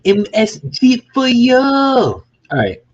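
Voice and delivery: put-on high, slow, loud Uncle Roger-style accent. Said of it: "Uncle Roger, stop him up like this".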